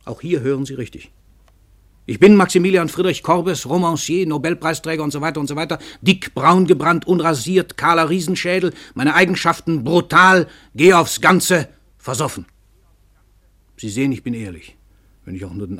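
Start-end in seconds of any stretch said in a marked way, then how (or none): 2.1-12.5 proud self-announcement. Acted. Korbes boasts introducing himself.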